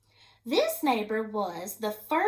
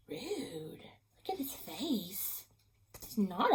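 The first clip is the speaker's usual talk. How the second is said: whispered aside; 4 semitones lower, 9 dB quieter, slower than usual. Real, not acted. Reader whispers an aside to the children, slow and hushed.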